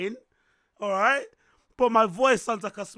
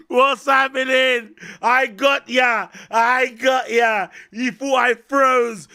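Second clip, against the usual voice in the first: hype shout; 7 dB louder, slower than usual. Acted. Hyped prank intro, shouting slowly.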